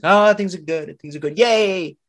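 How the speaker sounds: high, loud happy chirping; a hammed-up yay for good times; mock cheerful. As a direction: cheer like nothing can go wrong. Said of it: "It's like".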